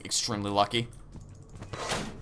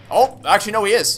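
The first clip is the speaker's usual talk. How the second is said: sudden surprise; 9 semitones higher, 7 dB louder, faster than usual. Real, not acted. Sudden surprise, pitch jump.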